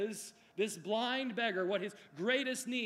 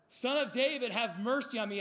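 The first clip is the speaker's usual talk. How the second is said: loud, raised plea, acted in preaching; higher, louder imitated call for help; 5 semitones higher, 4 dB louder than usual. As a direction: cry out for mercy as the beggar. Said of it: "and, and he calls out".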